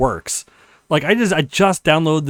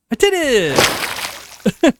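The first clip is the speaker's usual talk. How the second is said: slow, stretched-out cheer of I did it; high and drawn out in victory; triumphant; 10 semitones higher, much slower than usual. Acted. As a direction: celebrate the win, drawing out every word. Player clears obstacle.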